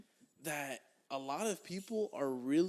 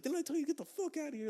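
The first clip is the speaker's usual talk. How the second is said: high, fast mock yell without the volume; retold yelling; 12 semitones higher, faster than usual. Retelling the guy yelling at them to get out, mock yell. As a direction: imitate someone yelling, pitched way up but held back. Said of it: "Like, yelling and shit".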